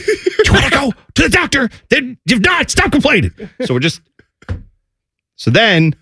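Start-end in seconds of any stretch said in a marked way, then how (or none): 0.0-3.3 high-pitched, strained mock scolding; exasperated parent. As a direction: hurry out a fed-up ultimatum. Acted exasperated parent arguing.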